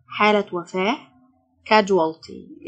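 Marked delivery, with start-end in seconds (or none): 1.7-2.7 casualty drawn out very slowly, syllable by syllable; teacher stretches casualty for the class; slow teaching demo. Shown as a demonstration. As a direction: stretch casualty out so students catch each sound. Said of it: "السيو تنطق الجه".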